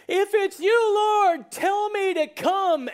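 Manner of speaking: high, loud, bold call; acted brash, urgent cry; Peter the loudmouth. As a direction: shout it out over a storm. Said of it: "kind of the loudmouth".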